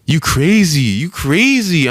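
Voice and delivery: high, loud mock protest, the second one slow; mock scared lady. Startled lady backing away from a yelling kid. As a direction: imitate a startled woman backing off. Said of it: "She was like".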